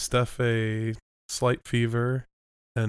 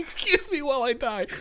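high-pitched laugh through words. Real, not acted. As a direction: gasp it out between laughs. Laughing hard.

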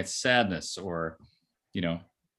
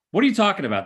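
much higher, louder indignant snap; dismissive partner; 10 semitones higher, 6 dB louder, much faster than usual. Retold or imitated. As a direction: brush them off like an irritated partner.

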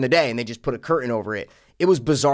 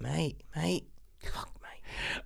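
whispered mock British mate; whispered British accent; 16 dB quieter, much slower than usual. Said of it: "That's your land, mate".

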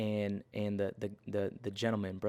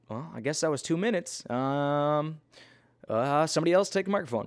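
mock awkward kid, higher pitch; sheepish kid preacher; 5 semitones higher than usual. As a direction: imitate a kid who has run out of sermon. Kid preacher runs out of material.

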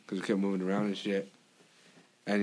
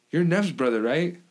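higher, brighter questioning voice of classmates; mimicking people; 6 semitones higher, 4 dB louder, slower than usual. Classmates asking if he is Neff's brother, mock curious.

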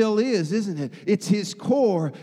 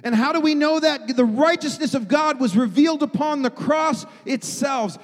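preaching at full force; 4 semitones higher, 3 dB louder than usual. Acted. Preaching crescendo on God's nature.

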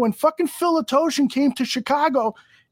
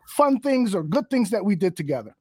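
ordinary delivery

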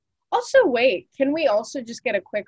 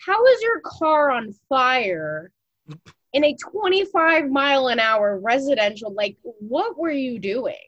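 loud, raised, drawn-out incredulous questions; mock disbelief; 4 semitones higher, 4 dB louder than usual. Onlooker to driver who wrecked on a residential street, incredulous. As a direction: demand an explanation in exaggerated bafflement.

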